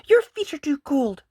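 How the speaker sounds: high, loud complaining bunny; grumpy sister. Acted. Mopsy grumbling at Peter's cold feet, high. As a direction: complain like a cranky little sister. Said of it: "said Mopsy".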